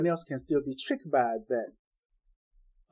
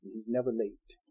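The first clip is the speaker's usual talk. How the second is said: ordinary delivery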